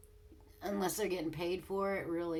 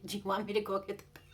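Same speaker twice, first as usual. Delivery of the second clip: fast, louder scolding question; rapid mock retort; 4 dB louder, much faster than usual. Wife firing back at husband, fast and sarcastic. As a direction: rattle it off at him.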